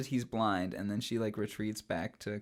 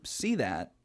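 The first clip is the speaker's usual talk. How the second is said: ordinary delivery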